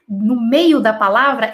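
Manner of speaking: usual speaking voice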